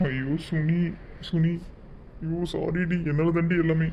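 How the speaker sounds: anguished, self-blaming cry to a lost loved one; tearful lament. Acted. Grieving man blames himself for the kidnapping, tearful. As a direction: lament with a touch of tears. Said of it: "இதுக்கு இப்படி அழுது என்ன பண்றது".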